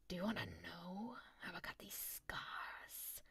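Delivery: slow, whispery villain voice; Joker. Acted. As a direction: draw it out slowly, soft and whispered. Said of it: "there are multiple Joker voices".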